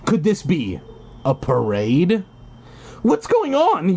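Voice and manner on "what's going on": surprised. A narrator's reading. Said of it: "you ask".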